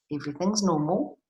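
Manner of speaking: plain reading voice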